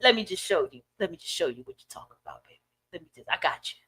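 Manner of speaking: low, very soft aside; voice of the universe. Acted. The universe confirming the speaker's point, low and soft. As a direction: murmur it low, like a knowing universe. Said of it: "The universe just be like".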